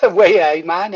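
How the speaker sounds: put-on loud laughing Geordie accent. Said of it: "the first Geordie I met".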